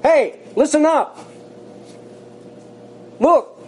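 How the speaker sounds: high, bright hey, listen up; urgent herald calling out; emphatic call to attention. Shown as a demonstration. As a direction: grab their attention like Mark does. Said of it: "the first word or phrase in verse three is".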